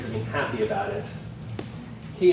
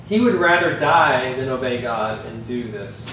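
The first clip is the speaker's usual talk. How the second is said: normal talking voice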